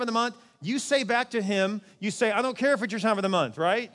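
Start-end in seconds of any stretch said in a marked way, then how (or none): 2.3-3.6 firm retort. Acted. Acted retort to the excuse.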